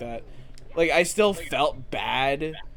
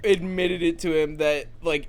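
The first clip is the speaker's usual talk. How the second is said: ordinary talk, nothing marked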